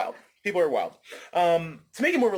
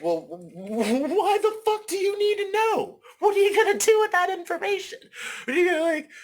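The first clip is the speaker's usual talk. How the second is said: outraged rant; 4 dB louder than usual. Acted. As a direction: stammer building to a high-pitched, loud demand. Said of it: "that's my rant".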